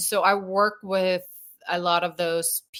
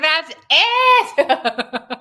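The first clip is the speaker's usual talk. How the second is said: laughing through a mock quit; high, loud, laughing; 9 semitones higher, 9 dB louder than usual. Quitting in exasperation, laughing.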